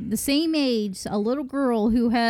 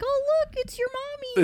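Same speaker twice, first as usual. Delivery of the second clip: cutesy play voice; 14 semitones higher, 4 dB quieter than usual. Retold or imitated. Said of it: "be like".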